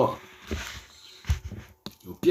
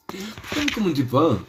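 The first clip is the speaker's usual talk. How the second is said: laughing through the question, higher; laughing repeat; 7 semitones higher than usual. Acted. Teasing the viewers again, laughing as he asks. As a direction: ask again while laughing.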